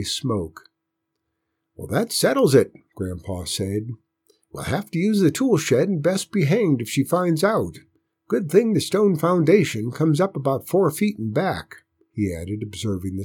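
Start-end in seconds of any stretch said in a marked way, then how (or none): 1.8-11.8 grandpa voice. A narrator's reading. Grandpa voiced, raised pitch.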